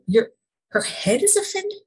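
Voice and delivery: did I really hear that?; incredulous. Real, not acted. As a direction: she doubts what God told her, rising in disbelief. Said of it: "Wait a minute".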